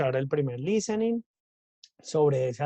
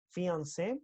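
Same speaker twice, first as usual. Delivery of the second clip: "Fiancé" with the final É stressed and rising; accent on final E; 3 semitones higher, 7 dB quieter than usual. Shown as a demonstration. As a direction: lean on the last syllable of "Fiancé". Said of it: "tiene como un acento ahí en la E".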